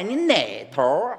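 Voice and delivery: strong Dongbei accent, slow. Shown as a demonstration. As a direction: lay the Dongbei accent on thick. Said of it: "就说强化一下".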